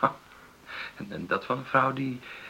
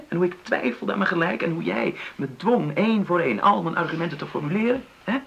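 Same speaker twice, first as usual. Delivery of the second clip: loud, high and urgent; heated, pleading insistence; raised voice; 7 semitones higher, 4 dB louder than usual. Acted. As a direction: press the point, voice lifted. Impassioned plea to partner.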